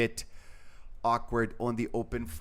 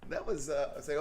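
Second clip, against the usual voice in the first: ordinary delivery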